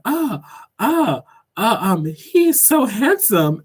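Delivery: mock flustered waiter, high, loud, drawn-out stammer; flustered, shaking. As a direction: imitate him stalling nervously, high and slow. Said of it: "shaking like that".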